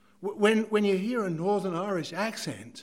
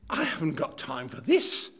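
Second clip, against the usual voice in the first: normal talking voice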